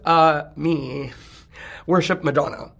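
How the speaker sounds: ordinary delivery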